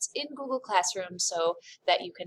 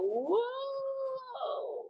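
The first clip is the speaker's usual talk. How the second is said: high startled whoa as the picture jumps; surprised whoa; 6 dB quieter than usual. Real, not acted. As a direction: react to a sudden slip. Teacher slips moving the camera.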